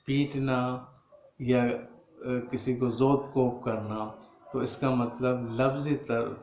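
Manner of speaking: ordinary talk, nothing marked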